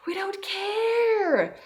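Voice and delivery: very high, slow squeaky little voice of the emotions; emotions voiced. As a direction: stretch it out high like a stubborn inner child. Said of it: "my emotions are like".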